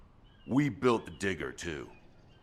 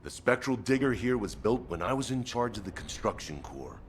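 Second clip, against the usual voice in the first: usual speaking voice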